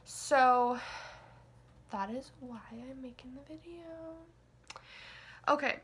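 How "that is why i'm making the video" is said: ordinary talk, nothing marked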